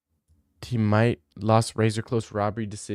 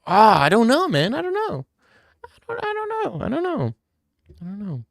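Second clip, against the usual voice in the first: high, indecisive squeak; high-pitched dithering; 11 semitones higher than usual. Real, not acted. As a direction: squeal it high, then let it shrink away. Playful whining about costume choice.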